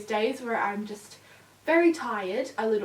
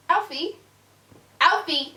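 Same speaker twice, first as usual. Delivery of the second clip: sharp raised call of the dog's name; calling the puppy; 4 semitones higher, 4 dB louder than usual. Real, not acted. Owner calling puppy off the tripod, sharp call.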